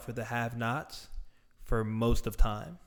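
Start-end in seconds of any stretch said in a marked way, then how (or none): none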